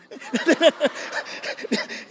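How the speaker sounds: breathy burst of laughter; real laugh. Real, not acted. Preacher amused by his poisoning joke, real laugh. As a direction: laugh at your own wicked joke.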